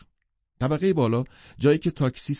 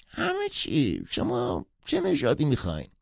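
put-on high, odd old peddler voice; old dog seller; 5 semitones higher than usual. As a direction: play the old street seller offering pups. (صدای پیرمرد فروشنده، زیر و متفاوت)

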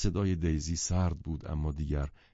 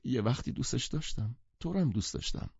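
despairing tearful; 6 semitones higher than usual. Acted. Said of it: "مأیوسانه شروع کرد به گریه کردن".

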